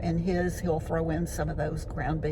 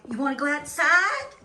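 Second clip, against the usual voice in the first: high, sing-song pet voice; talking to a dog; 9 semitones higher than usual. Real, not acted. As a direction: coo to the dog, high and bright. Greeting her dog Biscuit at home.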